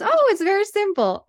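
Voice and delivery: put-on high, bright-eyed old rabbi chuckling it off; sweet old rabbi. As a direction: imitate a kindly rabbi who finds it obvious. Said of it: "this old rabbi, so sweet".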